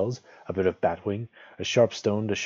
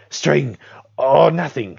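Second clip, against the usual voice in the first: high, loud Gollum screech; loud, frantic creature cry; creature shriek; 8 semitones higher, 11 dB louder, slower than usual. A narrator's reading. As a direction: shriek the guess out desperately. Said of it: "shrieked Gollum".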